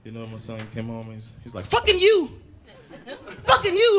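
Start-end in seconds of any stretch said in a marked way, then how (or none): 1.7-4.0 put-on high child yelling "Fucking you!"; shouting kid. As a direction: bark it like a cheeky five-year-old. Son repeating bad words.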